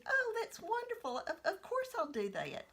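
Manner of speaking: put-on high, eager child's voice; delighted little girl. Little girl agreeing to the box trick, thrilled child voice. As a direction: gush like a thrilled toddler.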